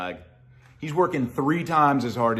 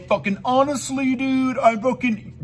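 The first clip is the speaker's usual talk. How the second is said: mock liar voice; 9 semitones higher than usual. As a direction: ham up a liar swearing honesty. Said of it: "they're lying".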